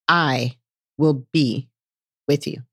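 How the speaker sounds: slow and low, broken by a pause; God's solemn answer. Acted. God answers all the excuses, slow low promise. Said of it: "And God's answer to him".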